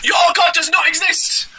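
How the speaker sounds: slow, high-pitched mock preacher shout; Cleveland Street Preachers. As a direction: proclaim it as a squeaky caricature preacher. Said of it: "here it is from Cleveland Street Preachers".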